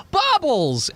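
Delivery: put-on high-pitched kid-like shout; sudden high, delighted cry; excited yell. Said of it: "they start to yell out".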